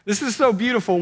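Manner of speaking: lifted, marvelling voice; delighted exclaim. Retold or imitated. Preacher marvels at Peter's cry, high and delighted. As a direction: gush over how beautiful it is. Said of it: "And I'm like".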